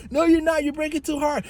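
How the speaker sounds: high whiny insistence; nagging friend. Retold or imitated. Passenger insisting the driver brakes too hard, high whiny mock. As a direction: whine it back up high.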